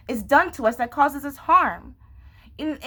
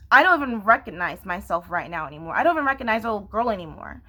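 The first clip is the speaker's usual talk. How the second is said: ordinary talk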